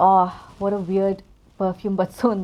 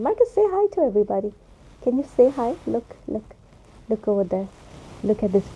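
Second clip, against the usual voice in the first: pet talk to cat; 4 dB louder than usual. Real, not acted. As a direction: coo at the cat so he greets viewers. Coaxing her cat on camera.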